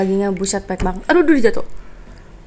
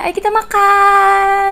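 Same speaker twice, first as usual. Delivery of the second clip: excited call; 8 semitones higher, 5 dB louder, much slower than usual. Real, not acted. Calling everyone to eat.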